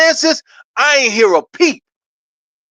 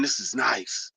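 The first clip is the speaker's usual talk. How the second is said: much softer and lower, almost to himself; hushed savoring; 11 semitones lower, 15 dB quieter, slower than usual. Real, not acted. Savoring the contract news alone, hushed and low. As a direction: breathe it out, savoring it.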